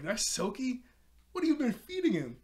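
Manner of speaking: high, loud mock astonishment; hammed-up incredulous questioning; shocked disbelief. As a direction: imitate a stunned friend, voice shooting up. Beast Boy shocked, retold.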